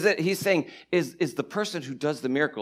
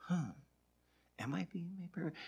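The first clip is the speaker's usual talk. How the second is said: very soft, puzzled musing; hushed imitation of someone mulling it over; mock pondering; 18 dB quieter than usual. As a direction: wonder it quietly to yourself.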